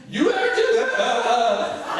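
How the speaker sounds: high, loud wobbly sob-laugh; high crying voice falling apart; mock crying. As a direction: squeak the threat and crumble into tears. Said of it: "I just started crying".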